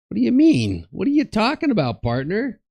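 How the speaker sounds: high, loud mock disbelief; indignant retort. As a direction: push the questions high and loud, incredulous.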